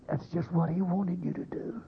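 very soft, confiding warning; hushed warning. Real, not acted. Preacher warning worried listeners about the devil's aim, hushed. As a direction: drop right down to a hush.

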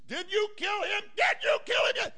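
high, loud shrieking accusation; a wife screaming at her husband; furious demand. Acted. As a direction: hammer the question at him. Herodias confronts Herod.

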